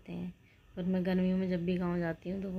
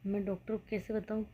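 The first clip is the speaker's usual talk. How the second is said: usual speaking voice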